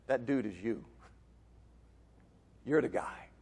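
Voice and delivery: low, very soft confrontation; hushed, low-pitched retold verdict; understated accusation. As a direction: drop right down and let it land quietly. Nathan confronting David, retold.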